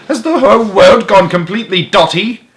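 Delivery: high, loud disbelief; outraged bellow. A narrator's reading. Duke at the end of his wits, incredulous bellow. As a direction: bellow it, utterly flabbergasted. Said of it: "he bellowed".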